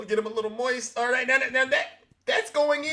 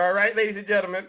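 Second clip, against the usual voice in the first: ordinary talk, nothing marked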